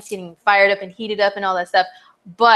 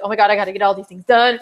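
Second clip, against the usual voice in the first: rushed, fast mock panic; frantic Mars; faster than usual. Mars in Virgo frets about tasks, fast mock panic. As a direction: rattle it off like a frazzled to-do list. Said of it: "Mars and Virgo is like".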